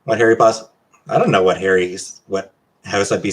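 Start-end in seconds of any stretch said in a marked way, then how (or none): none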